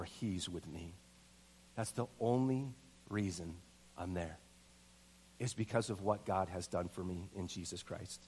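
low, soft and reverent; solemn, hushed preaching. Real, not acted. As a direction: bring it right down for the heart of the sermon. Solemn hushed preaching after the story.

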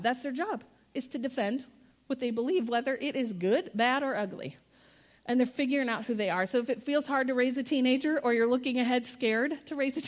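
ordinary delivery

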